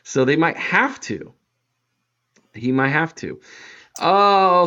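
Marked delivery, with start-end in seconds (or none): none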